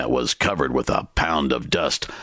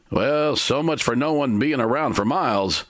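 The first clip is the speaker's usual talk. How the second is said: called out; 10 semitones higher than usual. A narrator's reading. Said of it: "Timber called out".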